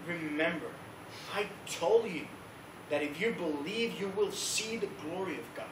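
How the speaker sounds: soft, measured reminder; lightly voiced, quiet assurance; calm Jesus. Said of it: "But he was like".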